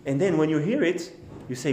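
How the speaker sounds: ordinary talk, nothing marked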